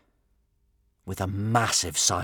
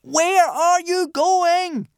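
high, drawn-out, loud holler; long-distance shout; 7 dB louder, much slower than usual. A narrator's reading. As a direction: holler it slowly across the water. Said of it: "cupped his hands and shouted".

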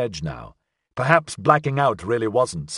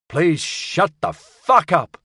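drawn-out exhausted curse; slow weary snap; 5 semitones higher, 4 dB louder, much slower than usual. Acted. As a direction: drag it out, fed up. Olga snaps at ship voice.

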